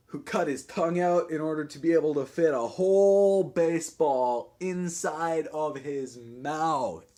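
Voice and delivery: slow, drawn-out stage declamation; punk rocker poem. Acted. Switchblade dedicates the show to buddy Billy, slow swaggering recital. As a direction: stretch the key words like a frontman.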